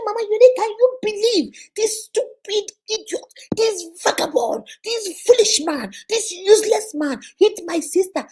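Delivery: high, slow, insulting rant; retold outraged rant. Brother raging to Mama Unique about his sister's husband. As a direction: spit out each insult one by one. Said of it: "He was screaming. He was yelling".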